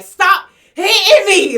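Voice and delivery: high, loud, slow mock plea; rival pleading. Rival begging her to stop hitting, mock plea.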